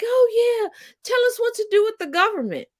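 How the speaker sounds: high, airy mocking voice of a gullible rich lady; mock Nancy Reagan. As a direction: parody her fawning over the psychic. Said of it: "And she's like".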